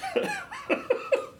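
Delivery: a sudden high squeaky giggle; high-pitched giggle. Real, not acted. Podcast host giggling at listener questions he can't say aloud. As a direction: burst into a high little giggle. Laughing at his own unspoken questions.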